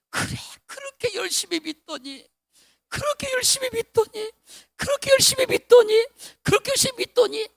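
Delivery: high, rising repeated exclaims from relatives; relatives marveling. Retold or imitated. Pastor voicing relatives who held his hand, high and marveling. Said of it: "말하는 거예요".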